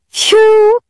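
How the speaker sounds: high, shrill-free witch cry pitched well up; a bat-like witch swooping down; high piercing witch exclamation; cried. A narrator's reading. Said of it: "they cried".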